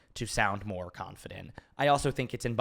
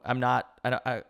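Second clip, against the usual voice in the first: ordinary talk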